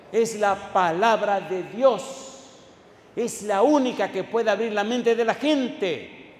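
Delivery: loud, stretched-out proclamation; emphatic preaching. Real, not acted. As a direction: proclaim it forcefully to the congregation. Preaches emphatically at full force. (proclamación enfática, a plena voz)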